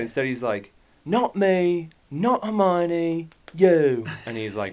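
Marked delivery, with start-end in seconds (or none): 1.0-4.1 slower, higher mock British accent, repeated; lightly put-on British accent. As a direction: quote Ron again in a British accent. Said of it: "I'll do it in a British accent".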